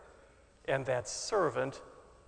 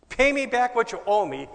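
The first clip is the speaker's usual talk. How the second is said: loud, raised demand for the money; 7 semitones higher, 9 dB louder than usual. A narrator's reading. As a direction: bark it at the debtor, higher and louder. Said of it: "he demanded".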